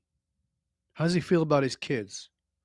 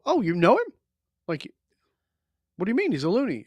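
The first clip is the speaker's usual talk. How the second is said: higher, questioning retort; acted surprise; 5 semitones higher, 3 dB louder, faster than usual. Man questioning his gym partner's snap judgement, surprised. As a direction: replay a puzzled challenge to a mate.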